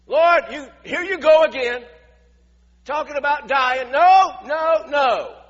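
loud, high-pitched objection; exasperated protest. Acted. Peter objects to the Lord talking of dying, loud protest. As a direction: cut in loudly, building to emphatic refusals.

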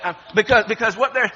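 usual speaking voice

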